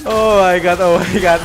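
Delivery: loud amazed caster outburst; excited shout. Real, not acted. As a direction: yell in disbelief at the play. Co-caster reacting to pentakill. (teriakan takjub yang keras)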